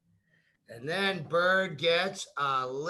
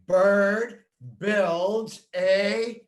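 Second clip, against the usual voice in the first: slow, louder, each word stretched; emphatic model line; 4 semitones higher, 7 dB louder, much slower than usual. Shown as a demonstration. Teacher modelling the sentence for a small child, slow and emphatic. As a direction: spell the words out big and slow.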